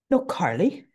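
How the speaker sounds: put-on a half-hearted, quickly dropped try at Anna's British accent; attempted Anna accent. Recapper trying Anna's British accent on Carly, half-hearted. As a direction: start Anna's British accent, then lose it. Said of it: "I can't even do her accent".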